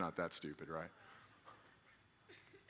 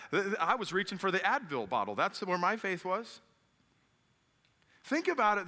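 raised, high-pitched sermon delivery; impassioned preaching; 8 semitones higher, 5 dB louder than usual. Real, not acted. Preacher challenging congregation about relying on God, forceful. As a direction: preach it hard, driving the point home.

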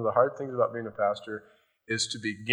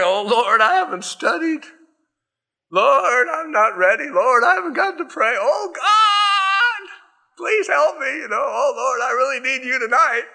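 much higher, louder desperate crying out; panicked prayer; 13 semitones higher, 6 dB louder than usual. Retold or imitated. Unprepared pastor begging God before preaching, panicked high plea. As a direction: beg God in a hammed-up panic.

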